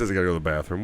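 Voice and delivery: low, soft mumbled excuse; mock excuse. A killer excusing himself to the bathroom, low and mumbled. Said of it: "he says".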